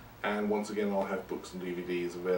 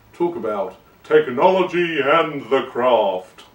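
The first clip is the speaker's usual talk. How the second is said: theatrical announcement; 5 semitones higher, 12 dB louder, slower than usual. Acted. Theatrical segue into topic.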